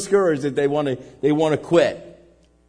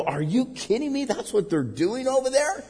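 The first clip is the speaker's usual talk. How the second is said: retold disbelief; 7 semitones higher, 5 dB quieter than usual. His shocked reply in Haiti.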